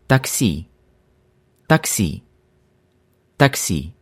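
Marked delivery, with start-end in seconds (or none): none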